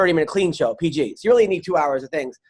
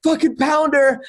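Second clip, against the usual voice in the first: meltdown shout; 7 semitones higher than usual. Retold or imitated. Comic still melting down on stage, shouting. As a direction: yell it like he's lost it. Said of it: "He's like".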